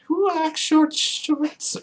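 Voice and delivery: squeaky high slow sing-song; falsetto joke. Pretend. Silly sung falsetto joke.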